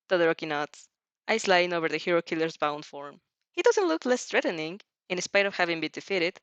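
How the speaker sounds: plain reading voice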